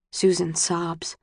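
ordinary delivery

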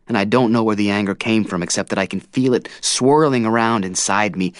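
ordinary delivery, nothing marked

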